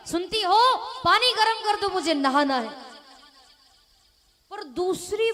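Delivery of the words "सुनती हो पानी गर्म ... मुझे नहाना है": calling louder and higher, more insistent; louder second call. Acted. Husband calls his wife a second time, louder. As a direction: call again, louder, losing patience. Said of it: "दूसरी बार फिर पति ने कहा".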